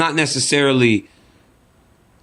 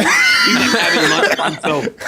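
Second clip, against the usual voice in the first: shrieking laugh at the fighter eating alone. Real, not acted. Laughing burst.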